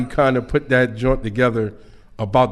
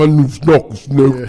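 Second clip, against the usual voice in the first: slowed-down deep voice; 5 dB louder than usual. Retold or imitated. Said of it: "like, a slow down voice".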